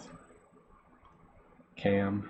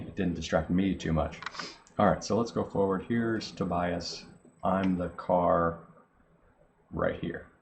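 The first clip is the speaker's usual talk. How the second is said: normal talking voice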